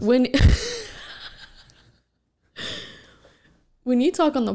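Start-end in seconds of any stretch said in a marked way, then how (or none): 0.3-1.4 sudden high, loud laugh; a bright, loud laugh that bursts out; burst of laughter. Real, not acted. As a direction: crack up at the teasing. Teased about shivering nerves.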